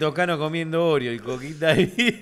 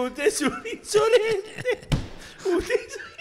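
put-on higher, slow, a touch of an indignant old gentleman; offended old man; slower than usual. Old man in a coat scolding the noisy fan, higher and slow. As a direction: play the affronted old man, laughing. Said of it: "y le dijo".

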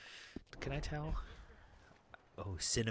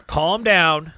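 loud, raised-pitch order; exasperated shout; 6 semitones higher, 16 dB louder than usual. Real, not acted. Gamer losing patience with game character, shouted order. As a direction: shout it as a command. Angry order after asking calmly.

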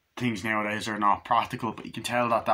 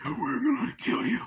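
high put-on threatening voice; mock threat; 11 semitones higher than usual. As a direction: imitate a menacing attacker in a high voice. Attackers' threat in retold scene.

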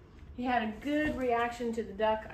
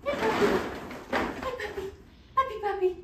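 high-pitched puppy call; eager, high summons to a puppy; calling a dog; 8 semitones higher, faster than usual. Real, not acted. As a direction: lure the dog over in a very high voice. Calling dog from across room.

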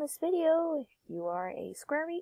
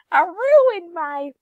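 ordinary talk, nothing marked

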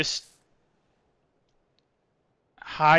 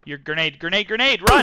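grenade warning yell; 3 semitones higher, 10 dB louder than usual. Real, not acted. Grenade thrown at teammate.

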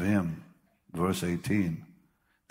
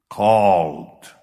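loud booming single word Called; Called, shouted loud to the church; proclaimed; 11 dB louder than usual. Acted. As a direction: hurl the word Called out at the congregation. Preacher's one-word proclamation.

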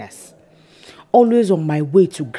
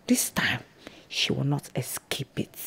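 quiet threat; 4 semitones lower, 12 dB quieter than usual. Acted. Villain vows revenge quietly.

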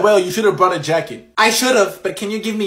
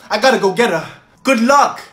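ordinary talk

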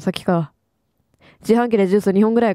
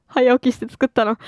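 usual speaking voice

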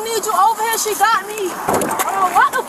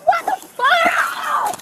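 pained shout; 6 semitones higher, much slower than usual. Real, not acted. Woman in pain during arrest.

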